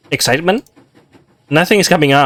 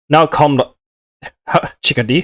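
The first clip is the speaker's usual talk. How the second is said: attempted devil voice. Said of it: "his voice".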